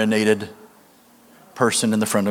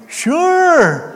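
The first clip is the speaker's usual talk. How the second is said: high, loud, drawn-out holler; whooped drunken yes; 5 dB louder than usual. Retold or imitated. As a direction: holler it out in one high burst. Drunk man's eager answer, retold.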